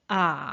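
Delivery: 아 sinking low, drawn out; Mandarin third tone. Shown as a demonstration. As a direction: let 아 slide down into the low dip. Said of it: "성은 어떻게 발음하나요?".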